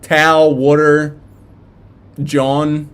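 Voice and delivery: loud South Philadelphia "Towel" and "water", water said like "wooder"; South Philly accent. Shown as a demonstration. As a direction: show off the South Philly way of saying it. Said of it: "that's just my accent from ... South Philadelphia".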